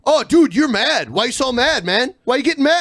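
high-pitched taunt, imitating a troll; mocking internet troll. Host imitates online trolls reacting when called out, mocking taunt. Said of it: "the other one is like".

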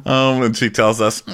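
ordinary talk

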